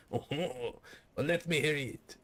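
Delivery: hammed-up French-Canadian father; French-Canadian dad. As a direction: imitate a skeptical dad with a French accent. Same dad, acted reply.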